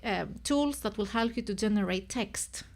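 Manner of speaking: ordinary talk, nothing marked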